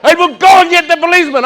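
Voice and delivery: high, loud shouting officer; officer losing his temper, yelling; mock enraged rent-a-cop; angry officer. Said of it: "he got very angry with me".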